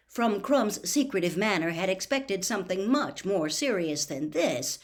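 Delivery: usual speaking voice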